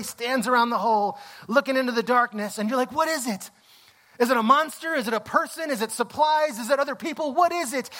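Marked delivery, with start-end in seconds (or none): 2.9-7.9 high-pitched, anxious string of questions; urgent wondering. Acted. Viewer asking what is down the hatch, urgent and excited. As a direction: fire off questions, high, as if dying to know.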